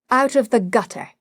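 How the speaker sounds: ordinary delivery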